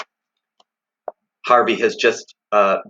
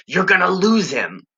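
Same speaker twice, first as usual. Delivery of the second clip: hissed urging; 8 semitones higher than usual. Acted. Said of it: "Jive hissed".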